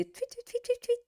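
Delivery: high-pitched tweet tweet; chirping bird. Pretend. Teacher chirps like birds for the student.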